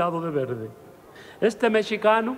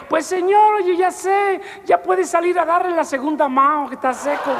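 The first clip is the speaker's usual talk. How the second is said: high, loud eager offer; eager punchline; 12 semitones higher, 6 dB louder than usual. Acted. Little man eagerly telling the gunman the paint is dry, loud and high. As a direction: cheerfully offer the second coat.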